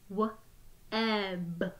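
each sound said separately with a short break; sounds tapped apart. Shown as a demonstration. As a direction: split the word into its sounds, one by one. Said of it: "Let's tap out the sounds in web".

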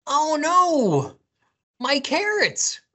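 high, loud rabbit wail; dismayed high storybook cry. A narrator's reading. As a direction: cry out over lost carrots. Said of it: "cried Rabbit".

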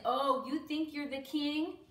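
crowd's scornful, exaggerated jeer; mocking. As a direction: taunt him with mock reverence. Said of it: "they were making fun of him".

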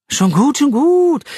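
exasperated "schon gut", climbing high; exasperated giving in. Acted. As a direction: throw up your hands, fed up. Jack gives up the duel.

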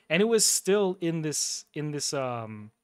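normal talking voice

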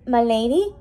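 plain reading voice

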